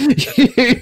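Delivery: high put-on cackle of a laughing dwarf; character laugh. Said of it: "Thron goes".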